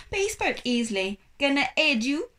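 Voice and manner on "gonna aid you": put-on French accent. A narrator's reading. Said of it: "His accent was thickly French".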